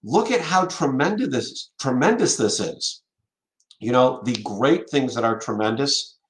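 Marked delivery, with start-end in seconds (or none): 0.0-3.0 mock gushing excitement. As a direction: gush about how great it is, mockingly.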